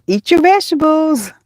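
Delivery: put-on high voice, playfully bossy; playful parental order. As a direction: call it out like a teasing parent.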